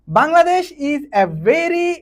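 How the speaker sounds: very slow, each English word spaced out; slow dictation. Shown as a demonstration. Teacher dictating an example sentence to students, very slow. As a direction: read it out word by word for the class. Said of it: "teacher reads example sentence".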